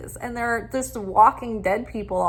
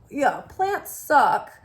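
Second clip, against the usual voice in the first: ordinary talk, nothing marked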